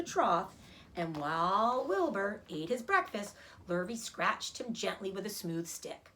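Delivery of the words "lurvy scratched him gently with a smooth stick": ordinary delivery, nothing marked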